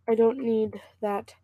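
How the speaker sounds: usual speaking voice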